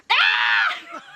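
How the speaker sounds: very high squealing scream; acted-out hysterical yelp; re-enacted yell. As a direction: demonstrate a panicked, high shriek. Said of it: "I'm, like, yelling".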